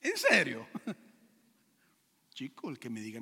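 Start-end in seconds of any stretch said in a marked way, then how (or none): 0.8-3.2 a real chuckle through the word; laughing. Real, not acted. Preacher laughing at wanting to be God, amused.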